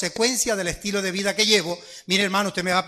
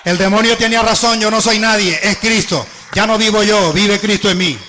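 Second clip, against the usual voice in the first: preaching at full force; 7 dB louder than usual. Acted. Preacher climaxes about casting out.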